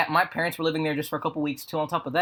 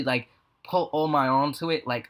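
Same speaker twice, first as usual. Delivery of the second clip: ordinary talk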